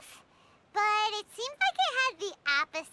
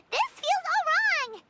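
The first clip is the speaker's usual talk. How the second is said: higher, louder and slow complaint; uneasy whine; 7 semitones higher, 4 dB louder than usual. Acted. Paimon cornered into agreeing, a slow high complaining whine. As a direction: drag it out in protest.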